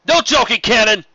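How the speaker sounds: almost shout it back; restrained yell. A narrator's reading. The lieutenant snaps back at Cannon, barely held-back yell. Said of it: "barely kept himself from yelling".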